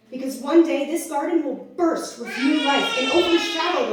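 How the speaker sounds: impassioned spoken-word build; triumphant slam poetry. Acted. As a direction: burst out with conviction. Recites own slam poem.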